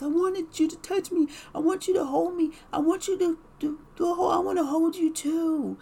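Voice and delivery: put-on high, far, pleading little-boy voice; childlike whiny husband. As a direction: whine like a needy child.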